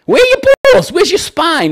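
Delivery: high, loud demanding yell; exasperated yell at a timid dad; incredulous shout. Real, not acted. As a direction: call him out, loud and high. Berating caller's weakness.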